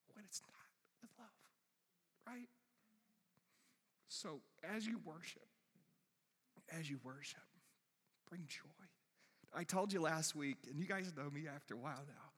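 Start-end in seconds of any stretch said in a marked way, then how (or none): 0.1-8.9 hushed, voice nearly giving out; choked up with joy. Real, not acted. Preacher urging the congregation to bring joy, choked up. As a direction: push soft words through welling tears. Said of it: "my joy comes out as tears".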